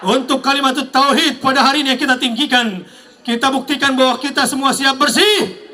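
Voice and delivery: rally speech. Acted. Rally call-and-response to crowd.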